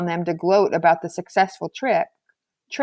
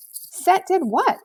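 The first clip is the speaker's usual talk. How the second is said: much higher, slow, stretched disbelief on what; startled question; 12 semitones higher, much slower than usual. Acted. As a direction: ask back, stunned. April baffled by Marshall's claim.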